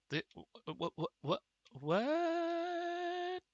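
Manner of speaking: stammering, then a high, loud what; real shock as the game glitches; stunned disbelief. Real, not acted. Player baffled at the bridge, stammering into a shocked what.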